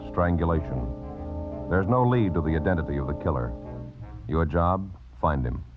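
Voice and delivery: ordinary delivery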